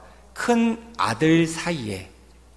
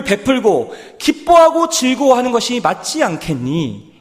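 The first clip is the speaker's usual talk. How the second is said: pleading father; 6 semitones higher, 7 dB louder than usual. Retold or imitated. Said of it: "아버지가 큰아들을 향하여 얘기하고 있는 겁니다".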